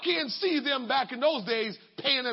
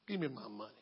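even lower, quieter demand; more menacing repeat; 12 semitones lower, 10 dB quieter, faster than usual. Acted. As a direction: drop it down, colder.

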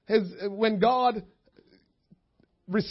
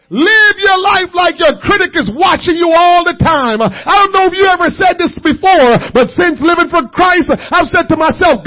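full-force preaching; 7 semitones higher, 13 dB louder than usual. Acted. Preacher urging the church to live as if watched, loudly. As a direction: drive it home like a closing sermon.

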